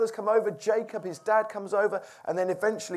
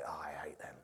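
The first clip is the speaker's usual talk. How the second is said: whispered, much softer bitter hate; a lightly voiced bitter [name], whispering; bitter brooding whisper; 11 semitones lower, 9 dB quieter than usual. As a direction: seethe it under your breath. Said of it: "Imagine it every day".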